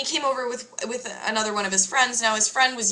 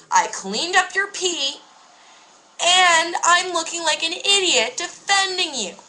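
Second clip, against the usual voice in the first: high, slow, heated complaint; fed up, higher and louder; exasperated; 8 semitones higher, 3 dB louder, slower than usual. Retold or imitated. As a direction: lay it out, fed up and rising.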